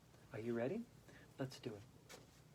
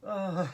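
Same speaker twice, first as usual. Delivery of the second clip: exercise laughter. Shown as a demonstration. Yoga teacher demonstrating laughter for the class, deliberate laughing. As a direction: burst out with an oh, then laugh it out. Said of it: "with laughter".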